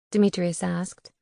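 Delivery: ordinary delivery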